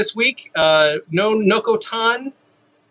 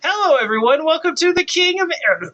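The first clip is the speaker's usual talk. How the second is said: high, bright announcer welcome; podcast host intro; 10 semitones higher than usual. Acted. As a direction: open the show like a host.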